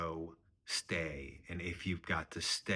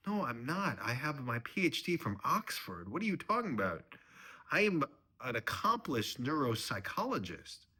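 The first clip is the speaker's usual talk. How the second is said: high, defensive objection; indignant protest; 7 semitones higher, 4 dB louder than usual. Acted. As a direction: defend your standing with rising indignation. Son-in-law defends himself indignantly.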